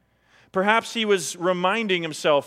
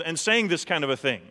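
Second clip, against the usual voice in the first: ordinary delivery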